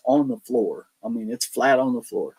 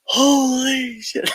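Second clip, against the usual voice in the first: frightened gasp; 4 dB louder, much slower than usual. Retold or imitated. Guys see the shadow figure.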